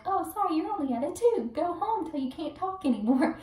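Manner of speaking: high, sing-song fake apology; mock-sweet brush-off; mocking imitation. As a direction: mimic dismissive hospital staff. Mimics staff sending her home.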